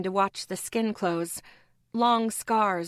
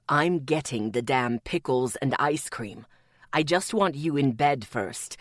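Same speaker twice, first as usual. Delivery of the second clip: deep, gruff man's growl; low growl; 7 semitones lower than usual. A narrator's reading. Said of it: "Atticus growled".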